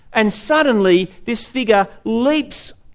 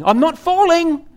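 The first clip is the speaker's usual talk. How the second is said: higher-pitched mock yell of a man falling; hammed-up denial cry, pitched up high; mock scream; 10 semitones higher than usual. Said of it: "he's screaming".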